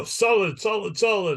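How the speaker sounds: high, bright showy "solid"; magician's assistant call. Retold or imitated. Assistant showing the box to the crowd, high call. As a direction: sing it out like a stage assistant.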